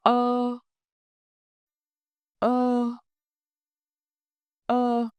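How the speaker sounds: uh held smooth from the throat, lips open, no push; slow, even uh for the class to copy; repeat the vowel. Shown as a demonstration. Teacher models the vowel for students to repeat, smooth and steady.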